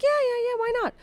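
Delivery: very high, airy reassurance; mimicked carefree English hosts; breezy hosts imitated. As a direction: rattle it off high, as if no big deal.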